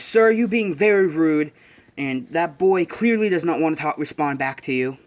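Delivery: acted-out stern telling-off; stern reprimand. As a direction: scold with indignant formality. Retold character scolds a rude man.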